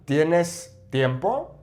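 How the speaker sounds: "tiempo" lifted in a rising question; slow Spanish question, pitch up at the end of "tiempo"; question intonation. Shown as a demonstration. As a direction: ask it slowly, voice climbing on "tiempo". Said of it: "change the intonation".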